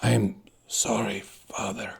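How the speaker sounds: faint unvoiced whisper, dying away. A narrator's reading. Inigo whispering to his dead father, weak from his wound. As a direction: breathe the words out, barely there. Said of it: "when he whispered".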